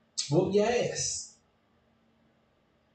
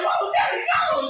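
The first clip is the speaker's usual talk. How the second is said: put-on very high, fast wailing child; crying kid; faster than usual. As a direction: wail it like a little kid. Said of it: "I'm like".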